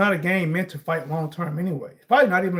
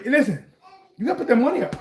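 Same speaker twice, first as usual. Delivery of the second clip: normal talking voice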